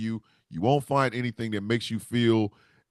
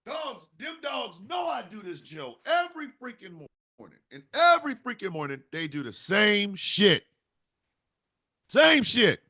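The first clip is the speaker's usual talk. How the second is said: exasperated yell; 11 semitones higher than usual. Real, not acted. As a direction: yell it like you've had enough of the dogs. Yelling about the dogs' routine.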